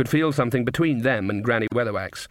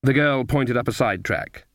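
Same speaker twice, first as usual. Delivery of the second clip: ordinary delivery, nothing marked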